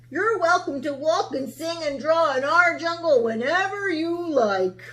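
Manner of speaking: reformed lion welcoming Sarah; friendly lion. Acted. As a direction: invite her kindly. Lion voice, warm.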